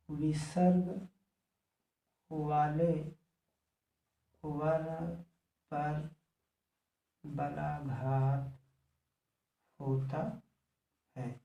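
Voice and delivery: one word at a time, long pauses; slow, isolated words; slow dictation. Real, not acted. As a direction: dictate word by word as you write.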